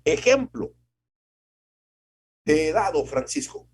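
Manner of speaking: stern divine rebuke. Acted. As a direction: scold him as the Lord would.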